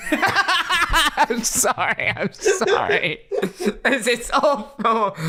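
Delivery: slow, apologising through laughter; sorry while cracking up; laughing apology. Real, not acted. Laughing fit after clip.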